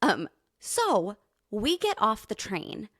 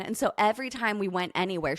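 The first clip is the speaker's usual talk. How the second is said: normal talking voice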